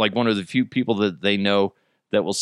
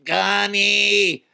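high monotone robotic wail; wailing robot meter maid; 11 semitones higher, much slower than usual. Acted. Robot meter maid watching a robot driver get shot, wailing. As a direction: cry out on one long high note, mechanically. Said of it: "a robotic meter maid".

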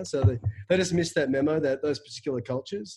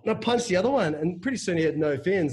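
ordinary talk

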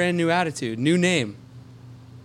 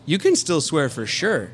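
usual speaking voice

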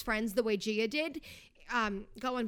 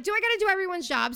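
higher, louder fed-up cry; exasperated outburst; 7 semitones higher, 6 dB louder, faster than usual. Retold or imitated. Stuck in LA traffic, a fed-up louder outburst. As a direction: vent it at the traffic. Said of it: "I'm like".

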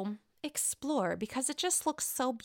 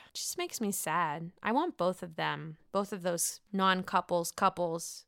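normal talking voice

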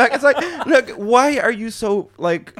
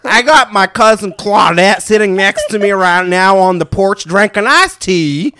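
loud, slow, hammed-up Southern drawl; stretched-out cowboy-style American accent; fake Southern accent; 2 semitones higher, 7 dB louder, slower than usual. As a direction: play a mock porch-sitting Southerner. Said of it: "Southern accents and stuff".

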